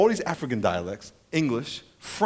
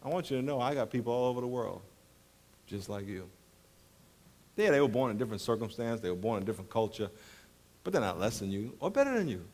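lightly voiced God, gentle; 9 semitones lower, 11 dB quieter than usual. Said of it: "God says".

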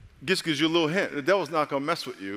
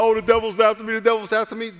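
loud, high-pitched imitation of a complainer; mock complaint; 9 semitones higher, 8 dB louder than usual. Preacher mimics churchgoers blaming the devil, mock complaint.

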